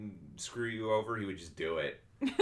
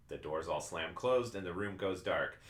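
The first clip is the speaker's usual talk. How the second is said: usual speaking voice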